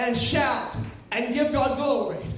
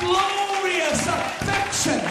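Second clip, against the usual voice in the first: swelling preacher cadence; preaching at full force; 5 semitones higher than usual. Acted. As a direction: stretch each word toward heaven. Builds to sermon climax.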